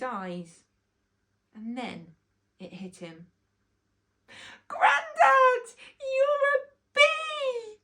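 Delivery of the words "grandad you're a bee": put-on high, loud child's cry of joyful recognition; a boy shrieking with joy, voice breaking; overjoyed cry. Boy realizing the bee is his grandad, high tearful joyful cry.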